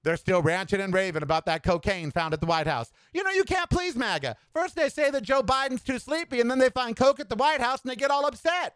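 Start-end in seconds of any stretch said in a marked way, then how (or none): 3.1-8.7 exasperated rant. Real, not acted. Exasperated rant about MAGA.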